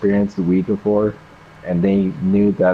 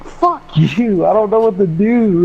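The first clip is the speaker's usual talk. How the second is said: panicked retort; 9 semitones higher than usual. Retold or imitated. Retelling panicked reply to friend.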